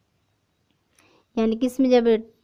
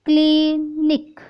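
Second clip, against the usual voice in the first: Clinic said slowly and higher; blended word announced; 5 semitones higher, much slower than usual. Shown as a demonstration. Teacher revealing the blended word to students. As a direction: stretch clinic out slowly, pitched up. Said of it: "C L I N I".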